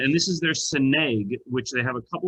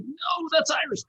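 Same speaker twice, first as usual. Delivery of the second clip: high-pitched, drawn-out mock objection; mock protest. Correcting a teasing colleague who mixes up Irish and Scottish, mock protest. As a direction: protest it in a much higher voice.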